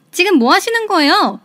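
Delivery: sudden outburst. Acted. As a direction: flare up at the mother-in-law. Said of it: "버럭했고". (버럭 화내며)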